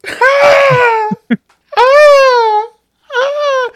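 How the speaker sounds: high loud screams of pain; warning ah ah ah. Retold or imitated. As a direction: shriek out in agony.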